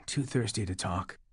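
soft, parched croak. A narrator's reading. Thirsty narrator declining to talk, soft croak. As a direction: croak it out through a dry throat. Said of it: "I croaked".

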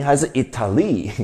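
"Italy" punched on its first syllable; stress on first syllable. Shown as a demonstration. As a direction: offer "Italy" with the opening I stressed. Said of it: "三个音节重音在哪".

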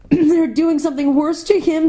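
high, urgent alarm; alarmed child fearing the worst; distressed girl. A narrator's reading. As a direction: cry out high in dismay. Lucy alarmed for Aslan.

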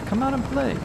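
high singsong taunt. Acted. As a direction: lure them out with a high tease. Repeated taunt higher.

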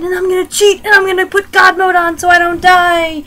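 high, loud shouted declaration; loud defiant whine. Acted. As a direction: shout it high like a stubborn kid. Playful outburst about cheating at game.

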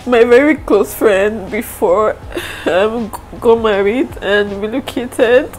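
high, tearful voice breaking into sobs; crying through it, barely holding together. Real, not acted. Vlogger tearfully telling viewers her close friend is leaving, crying. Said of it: "You guys have not seen me cry".

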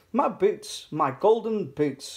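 high, delighted exclamation, slowing at the end; exclaiming. A narrator's reading. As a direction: exclaim at finding a lost treasure. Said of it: "she exclaimed".

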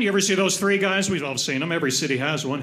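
usual speaking voice

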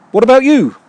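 high, loud put-on questioning voice; eager candidate. Candidate quizzing a team mate on outfits, high eager voice.

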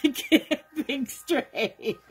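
slow, laughing at herself; laughing through words. Real, not acted. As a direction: laugh it out slowly. Laughing at own confusion.

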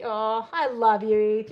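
slow, loud cooing. Real, not acted. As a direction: gush it fondly. Cooing at child Eth.

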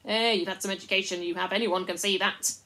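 loud admiring chorus; loud cheerful praise; loud fawning praise. Acted. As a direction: flatter her loudly.

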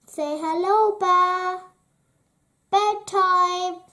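put-on high, loud little girl's command; bossy robber girl. A narrator's reading. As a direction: order it like a bossy child. Robber girl orders Gerda and pet.

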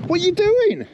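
high, startled question; real alarm in the voice. Real, not acted. Bailiff startled while being pushed in the car, alarmed.

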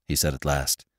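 ordinary delivery, nothing marked

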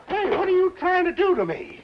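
high, alarmed yelp of a question; startled protest. Acted. Customer yelping at the barber, high and alarmed. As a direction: squawk in shock as the razor tugs. Rough haircut startles him.

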